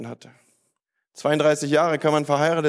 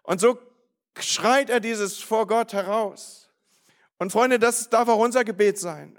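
raised-pitch, insistent sermon delivery; impassioned preaching; 6 semitones higher than usual. Real, not acted. Preacher urging the church to pray for wider influence, impassioned. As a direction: press each promise home in a high, steady push.

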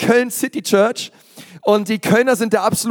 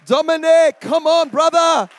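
high, drawn-out hype call; calling him up; 9 semitones higher, slower than usual. Real, not acted. Host hyping the guest pastor onto the stage, high calling.

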